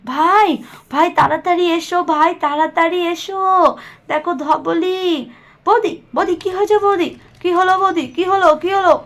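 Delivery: high, loud, hurried calling; urgent alarm. Acted. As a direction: shout for help in alarm. (উত্তেজিত হয়ে জোরে ডাকা)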